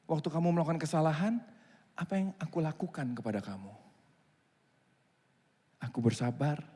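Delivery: usual speaking voice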